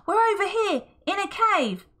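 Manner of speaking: helpful shout. Acted. Friday calling to the lost rainbow spirit, high shout. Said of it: "he shouted helpfully".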